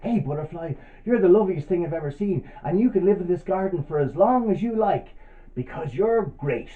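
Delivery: high, loud, gushing praise; delighted old lady. Acted. Old lady welcoming the butterfly to her garden, delighted. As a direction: call out to it, full of admiration. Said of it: "she said to the butterfly".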